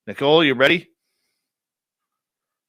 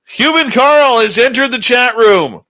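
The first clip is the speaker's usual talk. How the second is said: excited announcement; 10 semitones higher, 10 dB louder than usual. Real, not acted. Host hailing a chat regular, high and loud. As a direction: announce him like a star arriving.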